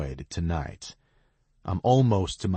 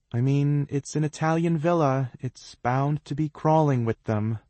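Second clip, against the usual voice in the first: uncomfortable; 9 semitones higher, 3 dB louder than usual. A narrator's reading. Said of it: "her discomfort is loud and clear".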